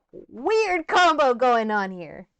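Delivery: drawn-out, high, very loud exclamation; loud amazement. Real, not acted. Viewer marvelling at an odd combination in a clip, loudly amazed. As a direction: draw it out slowly, high and booming.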